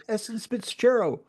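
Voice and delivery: retold "[name]", high and muddled; mispronounced name. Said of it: "I'd be like".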